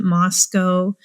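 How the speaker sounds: Moscow said MOSS-koh; local pronunciation. Shown as a demonstration. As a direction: end Moscow on a long O, like "go". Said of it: "I know they pronounce it".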